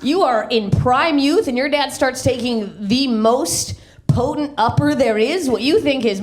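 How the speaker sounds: ordinary delivery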